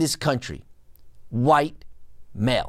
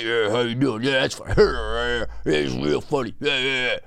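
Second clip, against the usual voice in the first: mock lowered voice, lazy and dismissive; mocking low voice; 5 semitones lower than usual. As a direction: sneer it in a put-on deep voice. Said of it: "but then you're trying to say".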